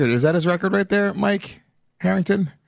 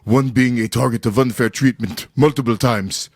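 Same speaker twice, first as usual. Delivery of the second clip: lower-pitched mock African accent; badly faked African accent; attempted African accent; 4 semitones lower than usual. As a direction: read it out in a put-on African accent. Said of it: "can I do this in an African accent".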